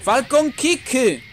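high, loud, drawn-out move call; attack shout. Acted. Gamer shouting Falcon Kick as he lands it. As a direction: stretch the move name like an anime yell.

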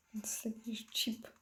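conspiratorial whisper; hushed secretive whisper. Acted. Conspiracy joke about a chip.

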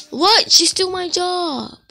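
high, loud outraged "What?"; shocked someone took his spot; indignant surprise. Real, not acted. Reacting to a player stealing his job, shocked.

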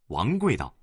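ordinary delivery, nothing marked

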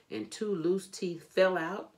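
ordinary delivery, nothing marked

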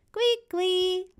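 put-on high little baby squeak; baby babble. Baby Suze answers big brother, high-pitched squeak.

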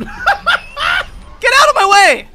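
high, drawn-out yell; shouting. Real, not acted. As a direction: yell it slowly, loud and high. Yelling at others in game.

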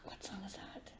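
barely audible whisper to oneself; very quiet, voiceless musing; whispered question. Real, not acted. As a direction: whisper it while searching your memory. Puzzling to self over tune.

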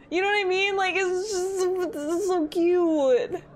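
high, drawn-out coo; cutesy gushing. Real, not acted. Streamer telling chat how cute the tails are, gushing.

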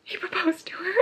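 awed breathless whisper. Real, not acted. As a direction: whisper it in disbelief. Whispered shock after finishing.

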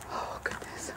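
breathless nervous whisper. Real, not acted. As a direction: let it slip out in a hush. Creeped out in graveyard.

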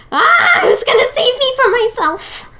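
high, loud laughing yell; playful high-pitched wail; mock panic. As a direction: cry out in hammed-up panic through a laugh. Comic panic at the mirror.

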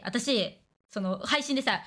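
ordinary delivery

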